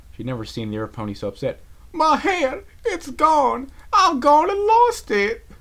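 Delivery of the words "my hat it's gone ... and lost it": high, loud, slow crying; tearful despair; sobbing wail. A narrator's reading. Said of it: "Applejack burst into tears".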